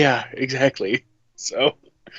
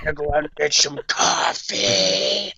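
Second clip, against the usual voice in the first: stretched-out cartoonish character voice; hammed-up mimicry; Penny; 3 semitones higher than usual. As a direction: put on a silly impression, dragging the last word. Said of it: "Like how Enma does Penny".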